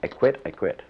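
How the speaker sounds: rising, heated repeated protest; angry disciple quitting in a huff; retold angry outburst. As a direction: storm out with it. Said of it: "finally I got so angry".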